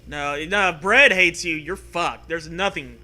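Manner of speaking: normal talking voice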